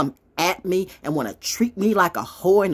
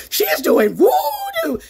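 very high, loud, drawn-out wail; mock alarm; 7 dB louder, slower than usual. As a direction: shriek it slowly like a spooky accusation. Mock alarm about witchcraft.

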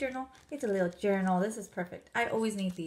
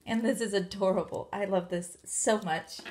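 ordinary talk

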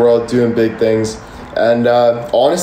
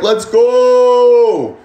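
higher, louder celebration; hyped cheer; 8 semitones higher, 3 dB louder than usual. Retold or imitated. Streamer recalling a big live payout, higher hyped cheer. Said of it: "I'm like".

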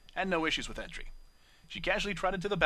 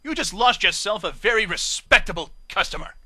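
haughty parting shot; 4 semitones higher, 10 dB louder than usual. Acted.

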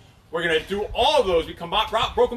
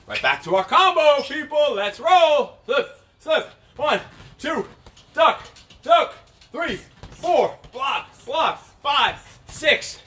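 boxing coach calling combos; 8 semitones higher, much slower than usual. Real, not acted. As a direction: shout the combo to the class.

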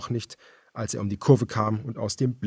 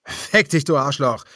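furious yell, higher and louder; enraged defiance; shouting; 5 semitones higher, 7 dB louder than usual. A narrator's reading. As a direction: spit the curse at them. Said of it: "schrie der Mann".

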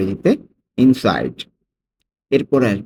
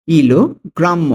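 slow, higher "কিলোগ্রাম্য", split after "kilo"; slow teaching take of "কিলোগ্রাম্য" in two parts; broken into parts; 5 semitones higher, 4 dB louder, slower than usual. Shown as a demonstration. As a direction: say "kilo", pause, then "grammo". Teacher repeats word by parts.

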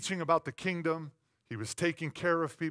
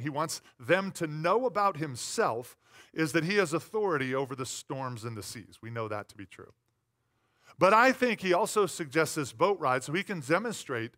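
ordinary talk, nothing marked

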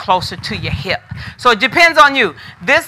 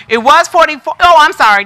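excited, louder blurted apology; all excited; 5 semitones higher, 4 dB louder, faster than usual. Real, not acted. Seller correcting herself on a sold item, all excited. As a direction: burst out higher as the excitement takes over. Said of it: "I'm getting all excited".

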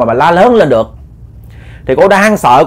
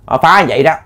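usual speaking voice

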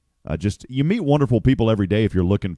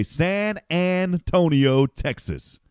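radio announcer flourish; 7 semitones higher, much slower than usual. Shown as a demonstration. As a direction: draw out each syllable of San Antonio like an announcer. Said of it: "I'd always say".

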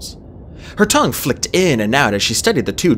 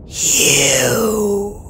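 drawn-out, rough dragon whisper; eerie creature voice; creaky, eerie rasp; 9 semitones higher than usual. A narrator's reading. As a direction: stretch the word into a creaky breath. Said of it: "creaky and quiet and rough and eerie".

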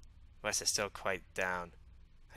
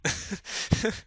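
high, loud burst of laughter; laughing; 11 semitones higher, 6 dB louder than usual. Acted. Reacting to supposed British humor, high laugh.

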